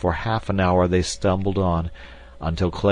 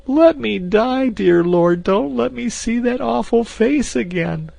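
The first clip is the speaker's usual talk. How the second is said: terrified pleading; 6 dB louder than usual. A narrator's reading. Frightened maid pleads with God, high terrified pleading. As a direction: beg the Lord for mercy.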